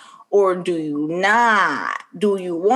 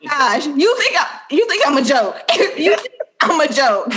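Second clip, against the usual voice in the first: high-pitched indignant scolding with laughter; hammed-up offended mother; mock angry mom; 7 semitones higher than usual. [name] confronting Bria, mock outrage breaking into laughs.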